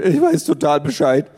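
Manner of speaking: put-on a gangly teen insisting he knows it all; boyfriend voice. A narrator's reading.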